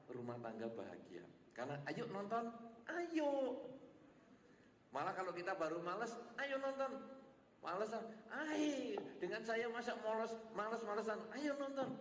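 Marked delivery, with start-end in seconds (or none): 1.9-11.9 eager coaxing. Acted. Spouse coaxing partner to watch.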